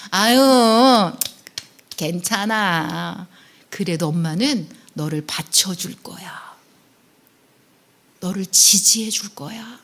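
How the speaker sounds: put-on slow, hushing comfort to a child; acted parental reassurance. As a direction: start with a long sigh-like coo, then soften. 자녀를 달래는 부모 흉내. (아이를 다독이듯 천천히)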